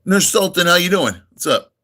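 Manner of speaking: usual speaking voice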